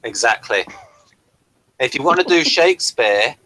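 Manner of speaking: ordinary talk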